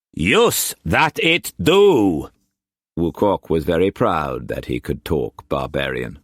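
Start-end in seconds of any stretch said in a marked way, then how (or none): 0.1-2.3 high, loud Cockney pirate-trader voice; Cockney swagger, pitched well up and pushed; harsh Cockney accent. A narrator's reading. Wu Kwok pressing food on Struan, in harsh Cockney accent. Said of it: "the harsh Cockney accent".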